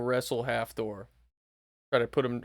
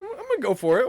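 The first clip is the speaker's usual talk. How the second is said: psyched up; 13 semitones higher, 6 dB louder than usual. Acted. Psyching up to drink pee.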